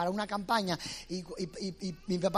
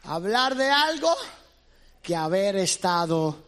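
slow solemn preaching; 6 dB louder than usual. Acted. Preacher drops back to slow.

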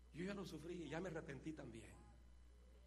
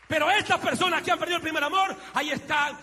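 roaring sermon climax; 11 semitones higher, 23 dB louder than usual. Acted. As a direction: drive the warning home with full voice. Preaching at full force. (clímax de predicación gritado)

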